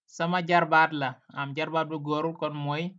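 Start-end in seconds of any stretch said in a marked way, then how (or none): none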